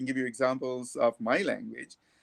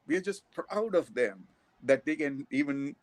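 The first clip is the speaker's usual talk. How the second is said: ordinary talk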